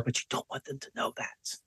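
hushed, whispered aside; confidential whisper. Shown as a demonstration. Coach joking that listeners may be crazy, secretive whisper.

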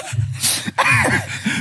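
soft, helpless burst of laughter; embarrassed laughter. Real, not acted. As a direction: laugh off the awkward slip. Laughing off an awkward slip.

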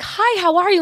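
high bright friendly greeting; chirpy sweet hi. Retold or imitated. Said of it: "tilts her head and's like".

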